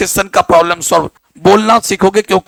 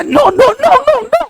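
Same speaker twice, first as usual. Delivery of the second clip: very high, hammed-up pleading squeal; mock beaten yelping; slower than usual. Pupil yelps as the teacher beats him, mock pleading. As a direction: imitate frantic cries under a thrashing. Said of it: "ओकर बाद जे उ मारने लगे उसको".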